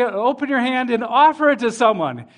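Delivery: ordinary talk, nothing marked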